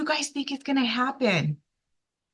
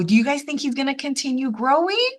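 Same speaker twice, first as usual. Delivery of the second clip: usual speaking voice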